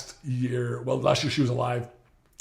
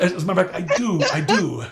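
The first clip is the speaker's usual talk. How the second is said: laughing; 5 semitones higher, 5 dB louder than usual. Retold or imitated. Laughing cheeky affirmation.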